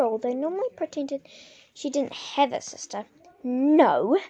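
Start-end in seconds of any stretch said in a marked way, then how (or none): 3.4-4.2 a sharp, loud, clipped no. Acted. Wife cutting off her husband's question, sharp and loud. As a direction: cut him off sharply. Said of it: "she said sharply".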